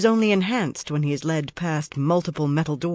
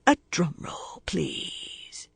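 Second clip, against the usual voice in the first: breathy stage whisper for a drum roll; mock whisper; 2 semitones higher, 5 dB quieter, much slower than usual. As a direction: whisper it like a cheap showman.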